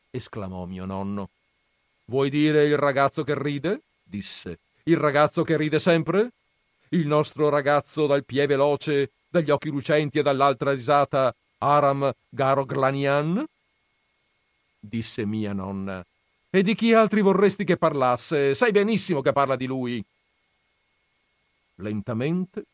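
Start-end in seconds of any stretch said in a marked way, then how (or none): 2.0-13.4 nonno incredulo, più forte e acuto; nonno sorpreso. Acted. 16.5-20.0 una nonna che rimbecca, più forte e acuta; nonna pungente. Acted.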